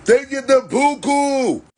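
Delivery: "buku" stretched out high and slow; incredulous emphasis. Real, not acted. Telling listeners about codefendants' sentences, a high drawn-out disbelief.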